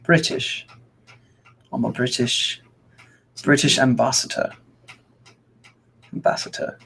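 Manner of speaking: badly faked British accent; attempted British accent. Shown as a demonstration. Streamer practising after friend's demo, clumsy British accent. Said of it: "I can't do it".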